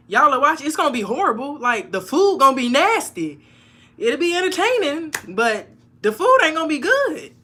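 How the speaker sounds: loud, high, excited hype; hyped up. Real, not acted. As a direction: hype the chat up. Hyped about cooking show.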